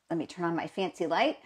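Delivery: normal talking voice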